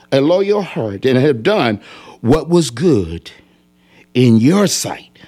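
slow, hushed plea fading to a whisper; solemn prayer reading. Acted. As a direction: pray the words, drop to a whisper at the end. Voicing Hezekiah's prayer.

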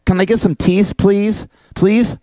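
toothless, high cartoon duck; mocking duck impression, pleading; Daffy Duck. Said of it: "That's why he speaks like this".

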